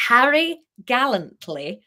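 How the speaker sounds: "Harry gallantly" slow and loud; slow deliberate read; careful slow take. Shown as a demonstration. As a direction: land "Harry gallantly" slowly. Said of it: "quite hard to say".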